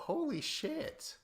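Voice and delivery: Holy shit stretched slow and soaring very high; stunned disbelief. Real, not acted. Reading a cast list and stunned by a hometown, slow high disbelief. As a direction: let it slide up in drawn-out amazement.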